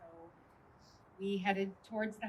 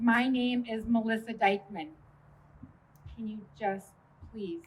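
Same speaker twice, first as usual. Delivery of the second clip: louder, pleading insistence; desperate loud plea; 3 semitones higher, 12 dB louder than usual. Retold or imitated. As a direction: push it out, begging the officer. Third plea, retold desperately.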